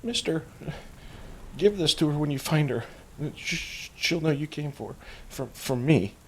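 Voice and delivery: put-on high, halting little-girl voice; young girl. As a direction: plead haltingly as a tearful child. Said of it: "she kinda yell".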